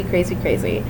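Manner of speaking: ordinary talk, nothing marked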